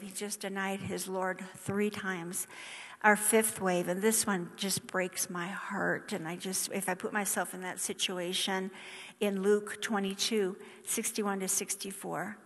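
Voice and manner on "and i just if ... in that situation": ordinary talk, nothing marked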